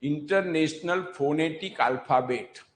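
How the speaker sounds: International ફોનેટિક alphabet spelled out slowly, word by word; slow explaining. Shown as a demonstration. Teacher expanding the abbreviation for students, slowly. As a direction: lay it out slowly so it sinks in. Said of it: "ખબર છે આઈ P H એટલે?".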